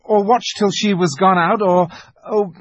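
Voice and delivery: put-on high, urgent boy grasping at plans; impassioned protest, pitched well up; agitated pleading. A narrator's reading. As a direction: argue desperately, stumbling for words. Peter argues desperately for a rescue.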